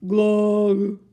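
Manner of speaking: gurgling horn glug; glug sound. Acted. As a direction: make a muffled glug. Trumpet makes a glug.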